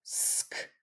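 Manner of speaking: voiceless "sk" breathed out; whispered blend sound. Shown as a demonstration. Teacher sounding out the blend for students, voiceless. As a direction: hiss the blend "sk" with no voice. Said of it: "Yesterday we did sk".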